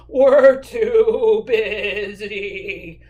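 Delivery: very slow, loud wobbling bleat; bleating sheep. Acted. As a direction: stretch every word into a sheep's baa. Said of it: "bad the sheep".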